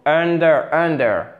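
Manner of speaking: "under," stressed on UN, weak ending; first-syllable stress. Shown as a demonstration. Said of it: "accentuation sur la première syllabe". (« under, » accentué sur la première syllabe)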